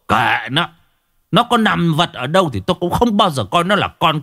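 high-pitched, indignant husband's rant; furious snapping. A narrator's reading. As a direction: rail bitterly against a disloyal son. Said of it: "điên tiết gắt lên". (gắt gỏng, giọng cao đầy phẫn nộ)

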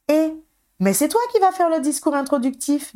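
high-pitched, surprised realization; découverte surprise. A narrator's reading. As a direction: exclaim it as you suddenly find out. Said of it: "découvrit Alicia". (surprise, voix haut perchée)

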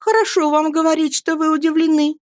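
high-pitched protesting wife; mother crying out. A narrator's reading. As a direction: protest in a high voice, no real shout. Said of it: "закричала матушка". (высоким голосом, с возмущением)

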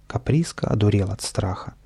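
ordinary delivery

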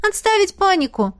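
high mock commanding order; imitating the rector. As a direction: playfully mimic a stern rector. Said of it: "копируя интонации ректора". (шутливо копирует строгого ректора)

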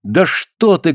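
acted woman's outcry; crying out. A narrator's reading. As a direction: cry out in dismay, without much volume. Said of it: "крикнула Алёна".

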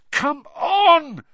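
high, loud fierce cry; fierce shout. Acted. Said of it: "Harry said fiercely".

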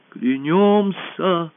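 high, long-drawn oath cry; crowd's oath. A narrator's reading. Whole hall swearing loyalty, drawn-out cry. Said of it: "прокатилось по залу".

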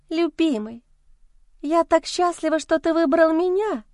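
put-on high, squeaky girlish voice. A narrator's reading. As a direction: squeal it like a giddy mistress. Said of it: "проверещала песклявым голосом любовница".

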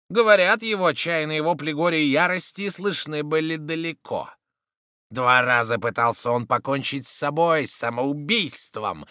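loud, grave preacher's tale; booming biblical narration. Acted. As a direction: proclaim it like a doom prophet.

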